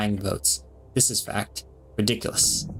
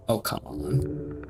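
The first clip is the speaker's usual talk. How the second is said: slow, breathy whispered groan of protest; exasperated whisper; 5 dB quieter, much slower than usual. Real, not acted. Gamer whispering at an on-screen debate, exasperated. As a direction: sigh it out under your breath, slowly. Frustrated aside at game debate.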